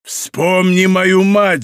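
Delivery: vengeful shout. A narrator's reading. Mordaunt galloping past his victim, vengeful shout. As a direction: stretch it into a long cry. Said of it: "закричал Мордаунд".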